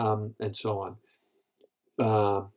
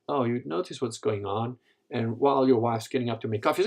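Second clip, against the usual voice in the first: ordinary delivery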